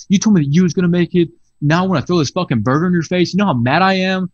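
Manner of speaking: fast, loud, high angry rant; furious customer. Retold or imitated. Customer yelling at the worker over too many onions, fast furious shout. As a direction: shout it out like he's about to throw the burger. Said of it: "this dude who's real mad".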